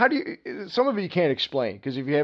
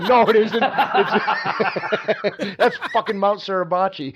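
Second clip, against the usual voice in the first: laughing; 5 semitones higher, 3 dB louder, much slower than usual. Retold or imitated. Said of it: "he said".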